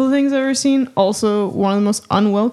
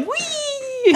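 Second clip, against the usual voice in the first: very high squealed whee; mock joyful whee; 4 dB quieter than usual. Daughter riding along in the Jeep, high gleeful squeal. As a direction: squeal it like a kid on a ride.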